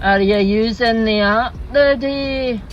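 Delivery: slow, droning drive-through voice; bored Scottish worker. Acted. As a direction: drag it out like unenthusiastic Scottish staff. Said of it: "I hear a voice going".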